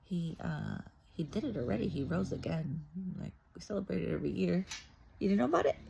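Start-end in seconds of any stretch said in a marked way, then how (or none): 5.2-5.9 high loud teasing disbelief; mock shocked teasing; mock incredulous. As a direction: tease them for not knowing. Mocking a sceptic.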